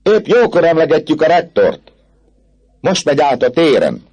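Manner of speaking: high, loud call from the window; excited student's shout. A narrator's reading. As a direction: cry the news out to the others. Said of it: "kiáltott az egyik diák az ablakban".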